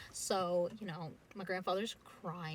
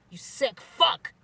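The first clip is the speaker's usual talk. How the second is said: louder and slow, biting each word; spat insult; 6 semitones higher, 13 dB louder, much slower than usual. Retold or imitated. Gwen snarling at the brother after slamming him. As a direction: drag out the insult with contempt.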